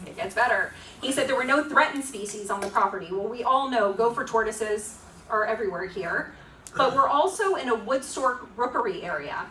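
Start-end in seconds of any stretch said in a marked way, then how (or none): none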